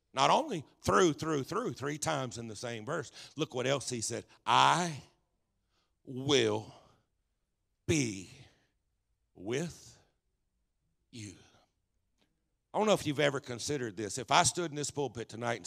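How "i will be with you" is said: one word at a time, long pauses; deliberate divine promise. Real, not acted. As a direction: let every word of the promise land alone. Preacher delivers God's promise.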